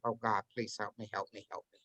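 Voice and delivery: low, very soft pleading prayer; hushed, low retold plea for help; praying under breath. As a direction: murmur a desperate prayer to yourself. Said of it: "praying the whole time under my breath".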